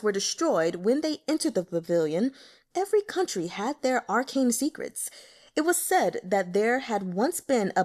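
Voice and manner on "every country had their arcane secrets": ordinary delivery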